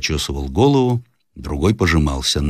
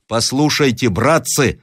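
mild cry of appeal; 6 semitones higher, slower than usual. A narrator's reading. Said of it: "вскричал Рославлев".

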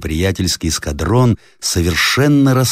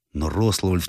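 usual speaking voice

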